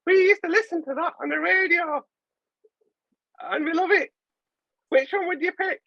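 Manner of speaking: very high put-on contestant voice; gushing contestant. Imagined dating contestant answering the host, high mock voice. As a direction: squeal it sweetly in falsetto. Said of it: "And they were like".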